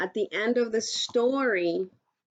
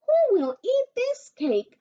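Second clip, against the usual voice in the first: high, louder sing-song question; sweet hen asking; 13 semitones higher, 6 dB louder than usual. Acted. Little red hen offering the cake to her friends, high and louder. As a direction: tempt them with the cake.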